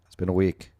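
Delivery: loud, exasperated sigh of a line. Real, not acted. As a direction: stress week, fed up. Exasperated remark, then repeated.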